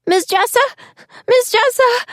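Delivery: put-on high, anxious child voices; small kids calling for their teacher; worried child calling. Buried children calling for Miss Jessa in the dark, worried. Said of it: "some of the kids kept asking".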